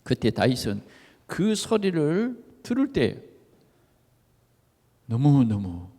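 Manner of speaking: normal talking voice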